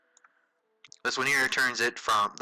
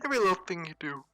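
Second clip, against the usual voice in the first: talking through a yawn; words stretched by a yawn; 5 semitones higher, much faster than usual. Real, not acted. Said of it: "I was yawning".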